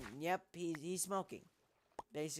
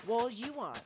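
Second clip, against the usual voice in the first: sharp, higher and louder comeback; childish retort; 5 semitones higher, 6 dB louder than usual. Acted. Captain snapping back at the woman, loud retort. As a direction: snap it right back at her.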